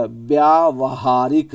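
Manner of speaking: व्यावहारिक drawn out syllable by syllable, vya-va-ha-rik; slow, careful spelling-out. Shown as a demonstration. Teacher drawing out व्यावहारिक for students to see. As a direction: say व्यावहारिक very slowly with a slight rise. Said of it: "ये देखो".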